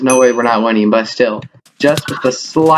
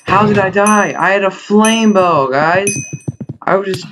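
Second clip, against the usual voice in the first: disbelieving outcry; 7 semitones higher than usual. Real, not acted. Just died despite flame bow.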